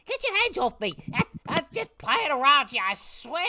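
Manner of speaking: put-on old halfling pleading in a squeal; shrill panic. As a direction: squeal it out, struggling to get free. Grabbed halfling protests.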